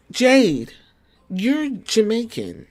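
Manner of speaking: ordinary delivery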